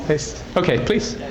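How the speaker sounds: usual speaking voice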